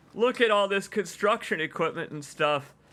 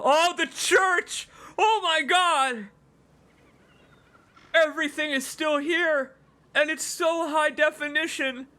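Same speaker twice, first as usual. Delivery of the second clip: awed excitement; 9 semitones higher than usual. Real, not acted. Fan seeing the remastered town, high awed excitement. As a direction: gasp at it like a dream come true.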